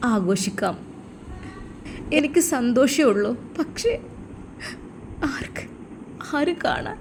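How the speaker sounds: soft, broken voice near tears; sobbing softly. A narrator's reading. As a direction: hold back tears as you speak. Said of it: "അവൾ വിതുമ്പി".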